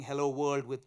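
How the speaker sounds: ordinary talk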